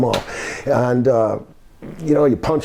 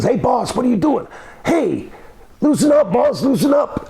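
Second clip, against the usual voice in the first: high-pitched alarmed protest; Coco; 10 semitones higher than usual. Retold or imitated. Coco caught in a face lock, imitated high protest. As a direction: plead in a high voice to be let go. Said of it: "He says".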